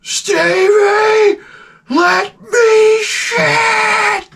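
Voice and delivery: high, very slow strained yell; father yelling. Retold or imitated. Comic imitating his friend's father yelling from the bathroom. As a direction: wail it from the toilet, dragging every word. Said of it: "his father goes".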